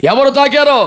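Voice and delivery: a mild mock shout over the crowd. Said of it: "అని కేకలేసేస్తుంటే".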